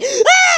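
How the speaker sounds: high startled gasp of realization; sharp gasp. Acted. Detective remembering the virus name, gasping. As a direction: gasp as it suddenly clicks.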